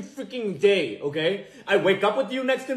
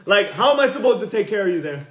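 ordinary talk, nothing marked

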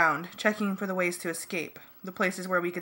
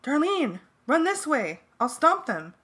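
raised, higher-pitched call; yelled instruction; 8 semitones higher, 4 dB louder than usual. A narrator's reading. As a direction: call out urgently to someone running. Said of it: "This guy named Hans yelled".

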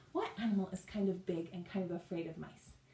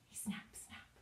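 whispered snap; 11 dB quieter than usual. Shown as a demonstration. Acting a crab.

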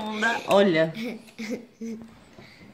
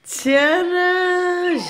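excited reveal; 9 semitones higher, 3 dB louder than usual. Acted. Revealing the bag to viewers.

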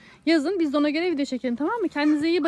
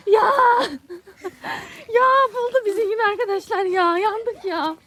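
high loud laughing yell; caught, laughing shout; 6 semitones higher, 5 dB louder than usual. Real, not acted. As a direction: shriek and laugh at being found. Found while hiding.

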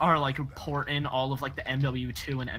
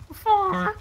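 voice crack; 9 dB louder than usual. Real, not acted. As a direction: pitch cracks up high on the word. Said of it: "Voice crack".

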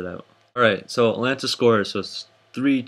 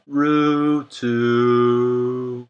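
very slow, stretched monotone holler; drawn-out snipe noise; 4 dB louder than usual. Acted. Host calling a snipe, drawn-out silly holler. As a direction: hold each syllable out long. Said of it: "I always do that when people snipe".

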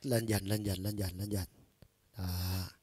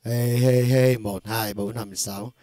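shouted count; 9 dB louder, slower than usual. Shown as a demonstration. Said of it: "các bạn thấy bình thường nè".

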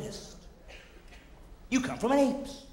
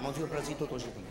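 lower, softer mock French compliment; a soft fake French flattery; mock French accent; 7 semitones lower, 7 dB quieter, faster than usual. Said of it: "I thought it was a compliment".

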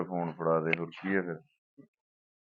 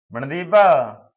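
loud call with a falling end; 5 semitones higher, 7 dB louder, much slower than usual. Acted. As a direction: call her name across the house. Calling wife from another room. (ਘਰ ਵਿੱਚ ਉੱਚੀ ਹਾਕ ਮਾਰਨਾ)

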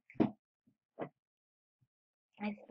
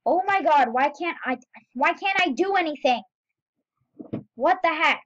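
louder, higher exasperated complaint; frustrated outburst; 4 semitones higher, 7 dB louder than usual. Real, not acted. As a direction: vent at the game, fed up. Frustrated at failing in game.

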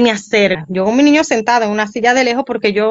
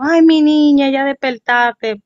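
high, loud relieved cry to a waking niece; relieved aunt; 5 semitones higher, 5 dB louder, slower than usual. Retold or imitated. Aunt relieved her niece finally woke, high loud cry. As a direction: gush with relief over the girl waking up.

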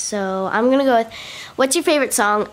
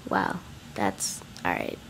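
slow whispered aside; 13 dB quieter than usual. Real, not acted. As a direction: whisper it slowly. Whispered self-correction.

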